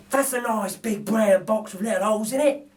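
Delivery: lightly voiced gruff burglar, slowed; a hint of a pleased thief eyeing loot; Burglar Bill voice. Narrator voices Bill admiring a toothbrush, lightly voiced. Bill's voice in read story.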